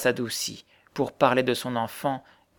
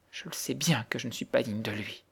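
touching humility; 9 dB quieter than usual. A narrator's reading. Girl owning her unworthiness to the widow, touching soft voice. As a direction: admit it humbly and very quietly. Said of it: "d'une voix touchante".

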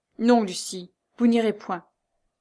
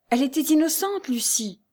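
high protest, falling on the name; crying out; 6 semitones higher than usual. A narrator's reading. Jeanne defending the condemned mother to Lucie, crying out. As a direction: exclaim it in urgent defence. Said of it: "s'écria Jeanne".

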